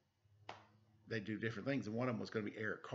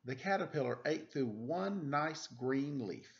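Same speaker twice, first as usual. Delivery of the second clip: put-on reading to children; 4 semitones higher, 5 dB louder than usual. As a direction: read it like a bedtime story for little kids. Reads picture book aloud to children.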